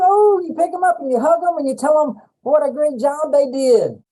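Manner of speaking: high, loud delighted voice; gushing. Retold or imitated. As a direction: keep the thrilled squeal going. Continues gushing voice.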